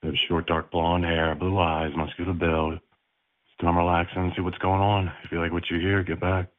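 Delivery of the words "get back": usual speaking voice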